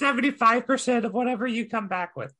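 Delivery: high, hesitant goblin voice; high goblin voice addressing the barmaid; timid goblin. Acted. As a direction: creep up and ask nervously, pitched high. Goblin demands a cut.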